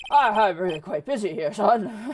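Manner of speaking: blustering, stammering lawyer role; evasive Grossberg. Acted. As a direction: fend them off with loud hesitations.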